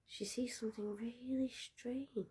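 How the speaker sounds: a storyteller leaning in, whispering; suspenseful whisper. A narrator's reading. Narrator whispering the strange discovery to listeners. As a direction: drop to a hushed whisper, holding the mystery.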